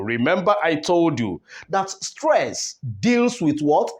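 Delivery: usual speaking voice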